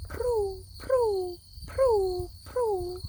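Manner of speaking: high cooing pigeon call; a small pigeon cooing for bread. Retold or imitated. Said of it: "They say".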